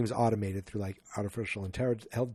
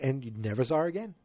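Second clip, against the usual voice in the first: normal talking voice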